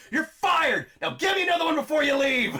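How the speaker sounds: high, loud hammed-up screaming tirade; abusive boss shout. Jim Carrey's abusive boss firing his assistant, shouting. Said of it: "this abusive dick".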